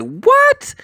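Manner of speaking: squeaky sky-high what; shocked what. Retold or imitated. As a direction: shoot the voice way up in pure shock. Final punchline of disbelief.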